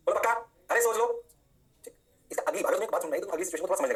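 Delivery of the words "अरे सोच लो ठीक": ordinary delivery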